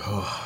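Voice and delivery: weary, soft exhaled "oh"; quiet, put-upon sigh; audible sigh. Acted. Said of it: "have an audible sigh like".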